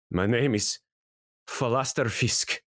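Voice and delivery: wounded man's lightly scratchy voice; halting, throat-clearing scratchy speech. Acted. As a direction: struggle to get your name out through a scratchy throat. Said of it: "through a sort of scratchy voice".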